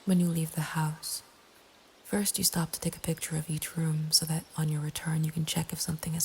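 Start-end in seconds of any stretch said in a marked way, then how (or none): none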